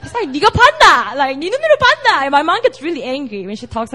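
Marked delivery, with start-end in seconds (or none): 0.3-1.1 louder, higher Busan dialect jab; a hint of a Busan mom challenging; short Busan-accented retort. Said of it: "like, Busan accent". 1.4-2.2 much higher, hammed-up Busan dialect; shrieking Busan-accented mom, mock; exaggerated Busan accent. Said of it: "like, Busan accent".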